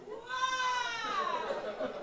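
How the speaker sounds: thin high squeal, held back in volume; soft, high-pitched comic howl; falling cry. Retold or imitated. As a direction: let out a small, high mock wail. Retold cry as she falls.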